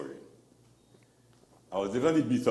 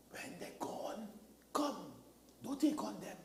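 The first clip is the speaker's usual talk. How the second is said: a hushed, softer whisper; 10 semitones higher, 9 dB quieter than usual. Retold or imitated. Landlady Mama quietly tells tenant to come later, whispering. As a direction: whisper it like a secret kept from relatives. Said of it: "And Mama will tell me".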